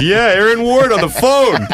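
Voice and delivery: high, jeering imitation; Dutch. Colleague outing his secret source on air, high imitated jeer. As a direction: blurt it in a high mock voice. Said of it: "And he goes".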